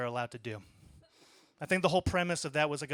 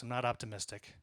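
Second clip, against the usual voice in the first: softer, lower deadpan aside; muttered repeat; 4 semitones lower, 6 dB quieter than usual. Real, not acted. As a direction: say it again under your breath.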